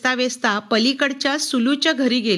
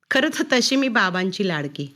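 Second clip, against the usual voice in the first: ordinary delivery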